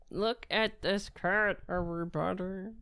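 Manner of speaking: slow, doting, drawn out; drawn-out cat talk. Real, not acted. Man showing his cat to viewers, slow doting coo. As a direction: stretch it out like cooing at a pet. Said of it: "Why am I talking like that?".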